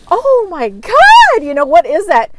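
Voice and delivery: high, slow startled gasp of fear; panicked cry. Retold or imitated. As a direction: cry out as if a ghost appears. Retold panic seeing ghost.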